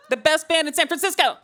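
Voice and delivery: loud, high hype shout; whooping plug for the band; rousing cheer. Acted. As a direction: shout it proudly to a crowd. Cheering on her friend's band.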